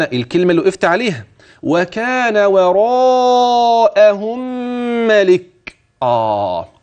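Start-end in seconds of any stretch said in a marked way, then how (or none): 1.6-5.8 وراءهم very slow, its connected madd held four counts; slow chanted وراءهم with a long madd; tajweed elongation. Shown as a demonstration. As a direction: stretch the long vowel in وراءهم four beats. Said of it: "أدي المد المتصل وهتمد أربعة".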